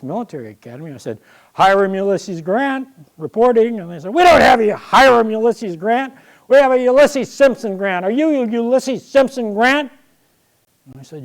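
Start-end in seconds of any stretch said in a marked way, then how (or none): 1.5-3.8 loud, eager reporting for duty; proud cadet report. Retold or imitated. Young cadet announcing himself at the academy, loud and eager. 4.1-9.9 higher, louder official questioning; officious clerk. Retold or imitated. Academy official questions the new cadet, loud and brusque.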